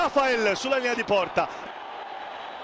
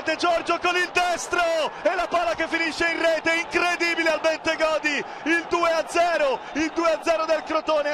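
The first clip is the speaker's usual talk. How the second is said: breathless italian goal commentary; 3 semitones higher than usual. Real, not acted. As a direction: yell the goal out to the fans. Second goal, shouting commentary.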